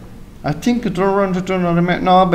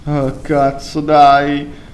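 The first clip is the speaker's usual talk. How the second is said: ordinary delivery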